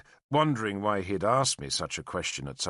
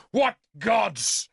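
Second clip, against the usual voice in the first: high, loud bitter outburst; furious; 11 semitones higher, 5 dB louder than usual. A narrator's reading. Arthur raging that no god came, loud and high. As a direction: hurl the question in rage. Continuing rage at the sword.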